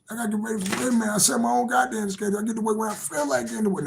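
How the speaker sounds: mock boastful boss. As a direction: lightly play a cocky self-employed guy. Now I'm just playing.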